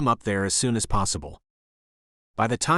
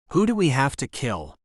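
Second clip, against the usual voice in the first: plain reading voice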